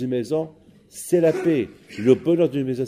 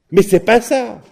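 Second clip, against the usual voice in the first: angry outburst; 9 semitones higher, 8 dB louder than usual. Acted. Old man rejects watermelon.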